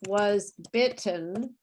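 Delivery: slow BITTEN with a clear T; English teacher diction. Shown as a demonstration. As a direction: spell out BITTEN by ear, T fully released. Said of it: "I'm pronouncing it, you know, like an English teacher".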